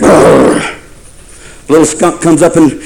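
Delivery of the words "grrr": low rough growl of a bear. Acted. Preacher playing a bear warning off an intruder, growling. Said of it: "The bear said".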